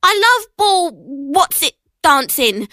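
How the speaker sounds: put-on high, slow fibbing boy; lying. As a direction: fib badly like a kid. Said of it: "he lied".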